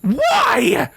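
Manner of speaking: high, furious roar; one explosive, high-pitched yell. Acted. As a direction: roar it out in rage. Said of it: "Scarlet roared".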